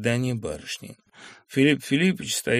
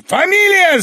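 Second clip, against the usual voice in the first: much higher, loud roar of the word Фамилия; 13 dB louder, slower than usual. Acted. Bormenthal roaring at Sharikov, terrifying fury. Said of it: "заревел он вдруг".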